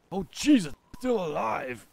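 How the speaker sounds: high, loud startled cry; panicked character's shout; acted alarm. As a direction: yell in sudden panic at the threat.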